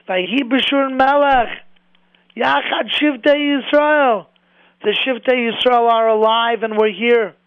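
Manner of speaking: triumphant proclamation. Retold or imitated. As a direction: proclaim the verse like a victory cry, stretched out. Proclaims verse and survival triumphantly.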